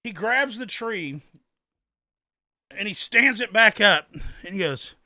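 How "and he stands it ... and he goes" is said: usual speaking voice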